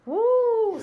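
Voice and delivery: high, loud woo; excited cheer. Real, not acted. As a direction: cheer like the page is a prize. Mom cheers turning the page.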